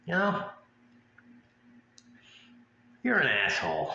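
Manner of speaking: meant in earnest, slightly laughing; drunk sincere insult. Retold or imitated. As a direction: say it like you mean it. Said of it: "and you're like".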